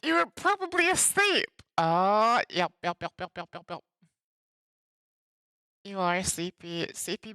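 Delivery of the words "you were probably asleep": high teasing. Acted. Teasing a viewer.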